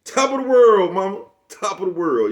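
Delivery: very high, loud, gleeful shout that fades down; loud gleeful movie line; movie quote shout. Retold or imitated. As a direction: belt the Juice line, then let it settle. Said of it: "my favorite part of that movie".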